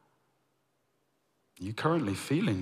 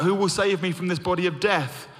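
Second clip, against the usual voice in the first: higher and louder anguished cry; 7 semitones higher, 5 dB louder than usual. Retold or imitated. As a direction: call out in anguish. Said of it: "Paul cries out".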